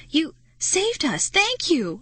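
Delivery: high, slow, grateful outburst; grateful cry. Acted. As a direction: cry out thanks to the rescuers. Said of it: "I cried".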